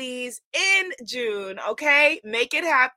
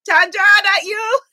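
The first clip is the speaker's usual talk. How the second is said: loud playful higher scold; mock scolding; 8 semitones higher, 9 dB louder than usual. Host teasing viewer Chandra, playful loud scold. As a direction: pretend to tell her off.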